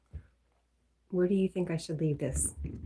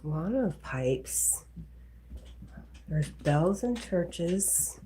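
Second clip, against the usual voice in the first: very slow, louder, drawn out; slow guess; 5 dB louder, much slower than usual. Real, not acted. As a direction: stretch the guess out thinking aloud. Guessing game answer.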